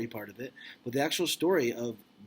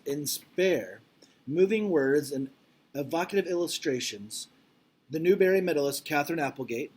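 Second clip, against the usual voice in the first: louder, expressive read-aloud voice; reading aloud to class; 3 semitones higher, 6 dB louder than usual. Acted. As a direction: read it out like a storybook for kids. Teacher reads book jacket aloud.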